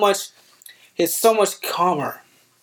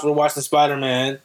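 normal talking voice

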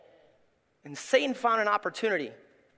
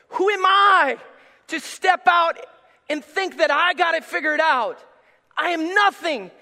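higher, louder, slow climactic preaching; a pastor preaching his humility before God at full force; 8 semitones higher, 8 dB louder, slower than usual. Acted. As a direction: build to a shouted sermon peak.

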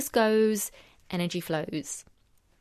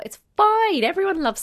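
bright exclaim; 10 semitones higher, 8 dB louder than usual. Real, not acted. Breezy insistence about smiling.

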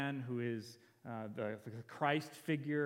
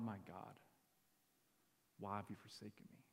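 hushed quoted cry; 4 semitones lower, 5 dB quieter than usual. Retold or imitated. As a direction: quote a forsaken prayer softly, with long pauses. Quoting Jesus on the cross.

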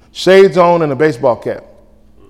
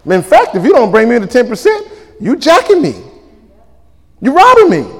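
God scolding; 6 semitones higher than usual. Retold or imitated. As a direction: climb higher with each charge. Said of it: "God said".

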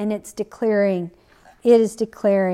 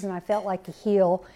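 usual speaking voice

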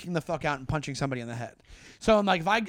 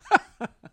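short, higher, louder mocking laugh; sarcastic laugh; 10 semitones higher, 7 dB louder than usual. Shown as a demonstration. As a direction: scoff at them with a fake laugh. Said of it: "I go".

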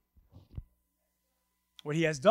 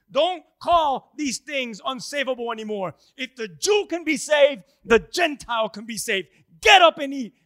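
raised, loud preaching as God's voice; forceful command; 3 semitones higher, 7 dB louder than usual. Acted. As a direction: build to an urgent shouted order. Preacher voices God urging Peter forcefully.

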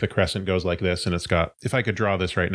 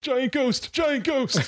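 high-pitched panicked cry; mock alarm; slower than usual. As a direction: cry out a warning in comic fright. Acts character crying out in alarm.

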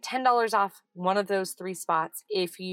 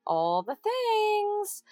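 sing-song; 14 semitones higher, much slower than usual. Real, not acted. Playful stretched ending.

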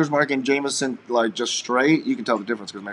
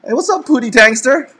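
excited greeting; 12 semitones higher, 9 dB louder than usual. Real, not acted. Greeting a live chat viewer.

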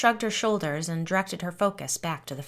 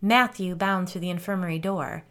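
ordinary delivery

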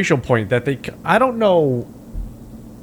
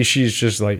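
ordinary talk, nothing marked

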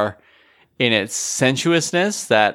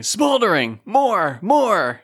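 high-pitched mock directing shouts; hammed-up yelling in a much higher voice; imagined director yelling; 10 semitones higher, much slower than usual. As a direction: bark it like an over-eager director. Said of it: "what he's yelling".

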